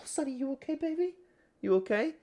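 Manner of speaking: high, sing-song puppy talk. Real, not acted. Talking to waking dog.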